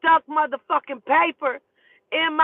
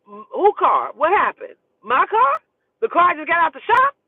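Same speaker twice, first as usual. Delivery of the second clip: shocked disbelief; 4 semitones higher, 5 dB louder than usual. Retold or imitated. Reacting to screamed news of fire.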